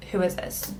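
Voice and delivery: whispered, soft and wondering; puzzled whisper. Retold or imitated. Staring at a stranger's message, whispering to herself. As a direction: breathe the question to yourself.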